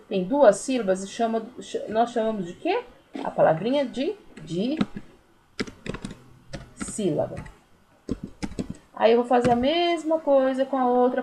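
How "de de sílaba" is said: "de sílaba" with a long pause after "de"; broken into syllables. Shown as a demonstration. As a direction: dictate "de sílaba" piece by piece, as if writing it.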